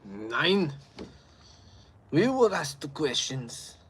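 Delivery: hammed-up German interrogator accent, drawn out.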